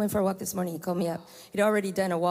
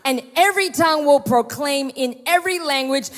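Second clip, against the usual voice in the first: loud, raised, driving sermon voice; rally the congregation to victory; preaching at full force; 7 semitones higher, 6 dB louder than usual. Acted. Preaching climax.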